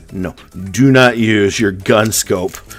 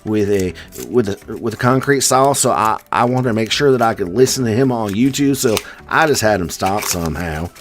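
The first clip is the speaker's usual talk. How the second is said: higher, hammed-up Southern accent; Brass Junkie, Southern accent; 4 semitones higher than usual. Viewer explaining himself to the news, mock Southern accent. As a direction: play an interviewed local with a bad Southern accent. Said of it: "made Brass Junkie into a Southern".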